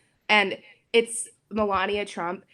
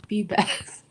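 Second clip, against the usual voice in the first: put-on Slovenian accent. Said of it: "can only be read in a Slovenian accent".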